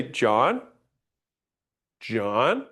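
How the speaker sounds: "John" said with a questioning rise at the end; rising tune on John. Shown as a demonstration. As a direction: call "John" with a rise, as if asking. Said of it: "or when I say".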